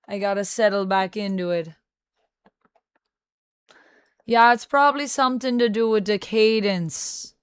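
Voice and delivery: put-on Jamaican accent slipping towards Irish; attempted Jamaican accent. Streamer chatting with viewers about vampires, shaky-fidelity Jamaican accent. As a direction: try a Jamaican accent, slightly missing it. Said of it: "this Jamaican accent that I'm trying and slightly failing".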